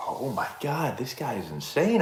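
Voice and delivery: hushed stunned thought; inner thought. Retold or imitated. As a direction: murmur it to yourself in disbelief. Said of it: "and I was like".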